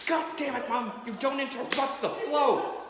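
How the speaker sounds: loud, high exasperated outburst; exasperated scolding. Real, not acted. Comic to parents interrupting the flow, loud exasperation. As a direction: scold the hecklers, half joking.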